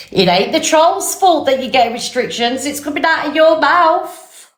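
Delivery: high, indignant rant; high-pitched retort. Real, not acted. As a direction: push it up high like a telling-off. Ranting at someone in high voice.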